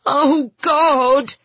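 panicked, loud high yell. A narrator's reading. Boy panics shut inside the van, yelled. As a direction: cry out loudly in panic. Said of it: "he yelled".

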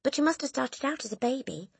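put-on high child's voice; little boy. A narrator's reading. Small boy answering the headmistress back, high voice. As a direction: reason innocently in a high voice. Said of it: "the boy said".

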